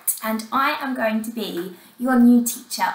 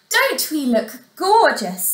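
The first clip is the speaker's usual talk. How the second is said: higher, excited stepsisters; preening, raised-pitch delight; mild shriek; 5 semitones higher, 4 dB louder than usual. Acted. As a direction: squeal it vainly without really screaming. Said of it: "they shrieked".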